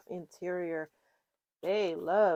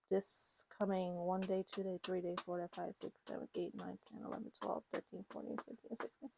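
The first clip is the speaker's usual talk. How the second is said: fading to a whisper; 10 dB quieter than usual. Acted. Counting days, fading away.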